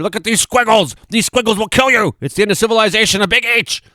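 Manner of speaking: high hammed-up doom voice; mock fearmonger. Parodying himself as a scaremonger, high mock panic. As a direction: squeal about squiggles like they're deadly. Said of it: "am I really trying to scare you here".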